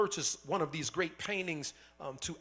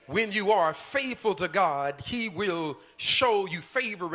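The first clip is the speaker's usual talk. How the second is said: louder, slower second time; preaching louder; 3 semitones higher, 6 dB louder, slower than usual. Shown as a demonstration. Preacher repeats the promise to the church, louder and slower. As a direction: hammer the promise home. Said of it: "hear me out".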